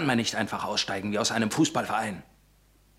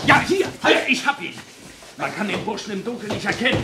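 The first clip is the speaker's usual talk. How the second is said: very high, loud triumphant cry; excited shout; 9 semitones higher, 6 dB louder than usual. Acted. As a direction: shout as you seize him. Grabbing intruder in dark. (aufgeregter, lauter Ruf)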